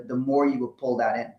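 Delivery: normal talking voice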